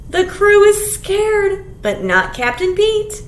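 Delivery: ordinary delivery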